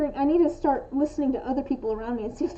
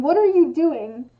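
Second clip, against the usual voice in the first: loud demo of an angry question; accusing; 8 dB louder than usual. Shown as a demonstration. Explaining the tonal context of the question, accusing. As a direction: hit each word like a telling-off. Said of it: "almost implies, like, anger".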